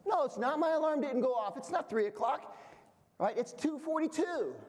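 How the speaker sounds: lightly voiced wife defending the time; wife insisting. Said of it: "She goes".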